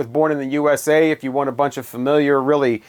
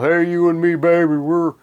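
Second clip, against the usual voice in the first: mock crooning [name] persona, a bit higher; [name] love song voice; 3 semitones higher than usual. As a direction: ham up a heartland rocker sweet-talking his baby. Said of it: "it doesn't have the whole".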